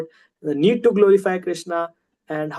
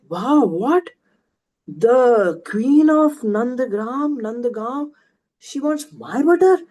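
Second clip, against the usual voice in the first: drawn-out, higher-pitched retold astonishment; amazed gopi; 4 semitones higher, 3 dB louder, slower than usual. Gopi hears the queen wants her butter, acted amazed. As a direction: act out a gopi's incredulous wonder, slowly. Gopi reacts to unexpected request.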